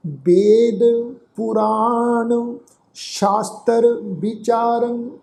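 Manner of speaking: slow, drawn-out sacred recitation; scripture recitation. Shown as a demonstration. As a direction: recite slowly, holding the long vowels. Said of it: "ਸਮੁੱਚਾ ਪਾਠ ਉਚਾਰਨ ਕਰਦੇ ਹਾਂ".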